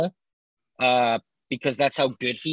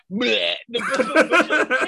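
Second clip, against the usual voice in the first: slow, laughing Butcher grunt; menacing butcher; 7 semitones higher, 5 dB louder, much slower than usual. Retold or imitated. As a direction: drag out a laughing bleh. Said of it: "let's hear your Butcher impression".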